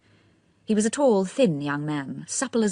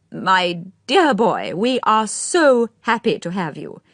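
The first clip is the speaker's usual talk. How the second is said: put-on a slow, fond elderly welcome; lightly voiced old lord, unhurried; slow old gentleman; 4 semitones higher, 7 dB louder, slower than usual. A narrator's reading.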